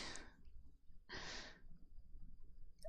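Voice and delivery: long quiet airy laugh; quiet trailing laugh; soft breathless laugh. Real, not acted.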